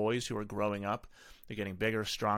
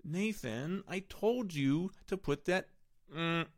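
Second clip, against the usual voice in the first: higher, slow, whining plea; whiny pleading parent; 7 semitones higher, slower than usual. Shown as a demonstration. Parent whining at son Nathan to put it down, pleading whine. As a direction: beg the kid like a worn-out parent.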